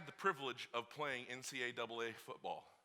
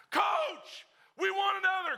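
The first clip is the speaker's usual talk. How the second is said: loud, much higher defiant yell; captains shouting; 9 dB louder, slower than usual. Retold or imitated. Captains shouting at the coach for another gasser. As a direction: yell it across the field. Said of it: "the captains again".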